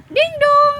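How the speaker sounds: high, loud sing-song ding dong; doorbell chime. Pretend. Mom imitating a doorbell.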